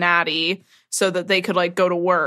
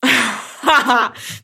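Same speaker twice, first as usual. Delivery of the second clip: laughing; 4 semitones higher than usual. Real, not acted. Laughs at joke.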